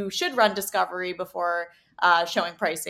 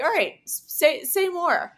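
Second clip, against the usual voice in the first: higher, slower, curious push; intrigued, ask them to go on; surprised prompt; 9 semitones higher, 4 dB louder than usual. Retold or imitated. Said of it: "And I was like".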